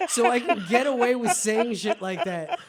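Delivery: normal talking voice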